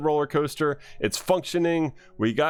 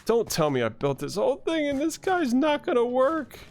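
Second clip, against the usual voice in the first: high, whiny disbelief; exasperated whine; 12 semitones higher than usual. Real, not acted. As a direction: whine up high as if the whole build is ruined. Exasperated at the llama not working.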